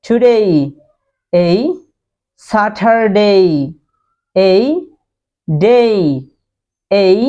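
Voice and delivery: teacher drilling the ei sound in words; drilled word list. Shown as a demonstration. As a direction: model এই then মে, টুডে with a gliding ei. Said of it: "তাহলে আপনি বলুন".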